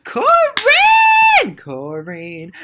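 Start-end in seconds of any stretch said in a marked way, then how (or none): 0.0-1.6 very loud, high scream of a name; thrilled shout. Real, not acted. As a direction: scream the fan's name in delight. Thrilled by a viewer.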